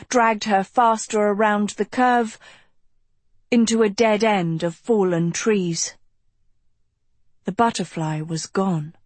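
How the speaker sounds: ordinary delivery, nothing marked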